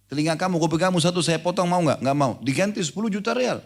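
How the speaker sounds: high, fast, eager bargaining voice; mock sheikh bargaining. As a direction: haggle like a pushy sheikh.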